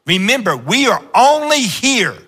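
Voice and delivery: high, rising preacher cry; fervent sermon build; preaching. Acted. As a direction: lift the line to a peak.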